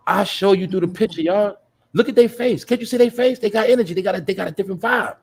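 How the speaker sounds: high, loud excited outburst. Real, not acted. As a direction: get fired up, pitch jumping way up. Excited pointing at successful people's faces.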